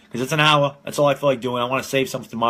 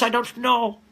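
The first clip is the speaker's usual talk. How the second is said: odd put-on voice; 8 semitones higher, slower than usual. Answering himself on stream, lightly in a high put-on voice. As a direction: still the high put-on voice, a touch. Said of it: "Why am I talking like this?".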